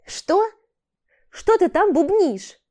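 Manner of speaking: high, loud, slow question; shouting up. Acted. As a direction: shout up from below. Jim shouts from pit.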